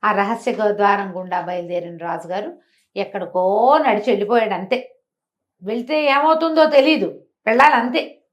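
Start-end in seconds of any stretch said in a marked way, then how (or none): none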